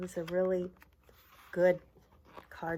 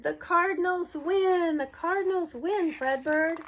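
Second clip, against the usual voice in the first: cheering crowd; 9 semitones higher, 5 dB louder than usual. Acted. Crowd celebrates the Cardinals win, loud victory cheer. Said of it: "the crowd cheered".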